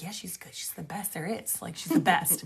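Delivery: very soft, low retold confiding; hushed assurance. Friend vouching for the medium to a stranger, hushed. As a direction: drop to a near-whisper to vouch for her.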